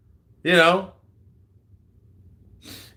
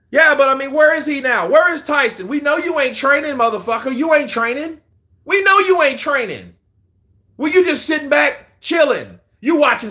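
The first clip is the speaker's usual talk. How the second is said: taunting call-out; 9 semitones higher, 4 dB louder than usual. Acted. Calling out Tyson Fury.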